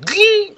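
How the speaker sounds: sudden high-pitched "ding"; record scratch sting. Retold or imitated. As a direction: imitate a montage screeching to a halt. Imitates montage breaking, record scratch.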